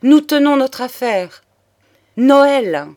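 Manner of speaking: loud, high triumphant exclamation; the count, struck by an idea, cries out loudly; triumphant cry. A narrator's reading. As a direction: call out the name Noël with sudden joy. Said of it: "s'écria-t-il".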